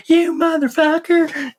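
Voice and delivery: high, drawn-out imitation of a cursing wife; sweet wife swearing. Husband imitating his wife cursing while driving, mock outburst. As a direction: stretch it out high, imitating a wife's road rage. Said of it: "here's my sweet wife driving down the road".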